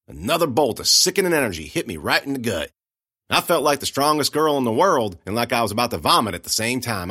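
usual speaking voice

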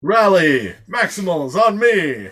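loud slow rallying shout; rally the troops with a shout; battle cry. Acted.

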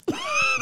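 squeaky burst of laughter; high laugh. Real, not acted. Laughing at the ripped glove.

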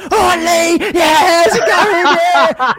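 very high, loud gushing greeting; overjoyed to see him again. Retold or imitated. Re-greet at midnight.

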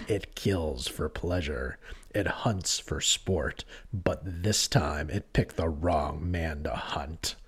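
slow, soft movie trailer voice; drawn-out dramatic trailer read; trailer voiceover guy. Retold or imitated. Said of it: "Did you like my voiceover".

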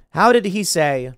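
ordinary talk, nothing marked

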